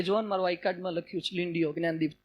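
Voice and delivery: small creature insisting shyly; meek lion cub. Acted. Cub shows the lion its ID card, meek and soft.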